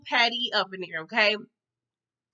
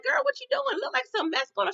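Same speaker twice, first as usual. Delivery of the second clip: high-pitched, disbelieving friend; scandalised questioning; 14 semitones higher than usual. Retold or imitated. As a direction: imitate a friend catching someone misbehaving. Candace confronts Van over drugs.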